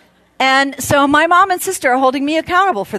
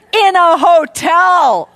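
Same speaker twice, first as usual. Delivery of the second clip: exasperated exclamation; 7 semitones higher, 6 dB louder, slower than usual. Real, not acted. Exasperated punchline.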